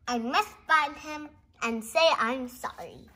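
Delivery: normal talking voice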